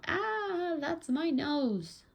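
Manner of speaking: very high storybook "ah", then drawn-out words; high acted yelp of pain, slowly wailed; yelling in pain. A narrator's reading. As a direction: squeal it like a child being yanked. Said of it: "Kate yelled".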